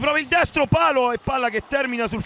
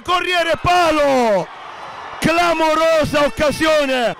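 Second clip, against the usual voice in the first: excited commentary; 5 semitones higher, slower than usual. Real, not acted. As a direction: gasp out the near miss. Shot hits the post.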